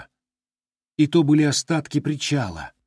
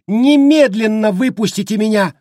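hysterical scream; 10 semitones higher, 8 dB louder than usual. A narrator's reading. Miss Raise screaming hysterically to be freed. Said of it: "истерично закричала мисс Райсе".

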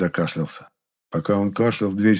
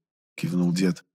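ordinary delivery

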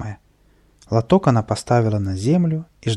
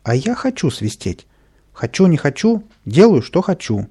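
higher, louder bratty insistence; defiant child; 6 semitones higher, 4 dB louder than usual. A narrator's reading. Capricious boy defying the sergeant over whistling, defiant.

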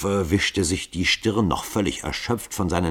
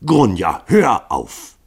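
energisch; 5 dB louder, much slower than usual. Acted. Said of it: "befahl energisch".

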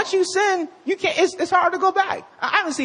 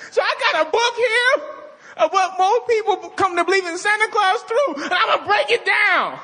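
mock anti-Santa blogger; 9 semitones higher than usual. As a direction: rage at Santa like an obsessed blogger. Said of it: "It's like me having a Santa Claus blog".

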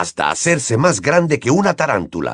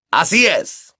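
higher-pitched, firm approval; approving; 8 semitones higher, slower than usual. A narrator's reading. Moody confirms his pupil's answer, approving. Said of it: "aprobó Moody".

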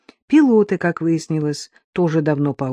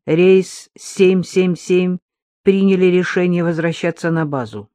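crisp radio call; 3 dB louder than usual. Acted. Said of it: "поднял губам микрофон".

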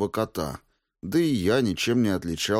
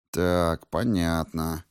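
ordinary delivery

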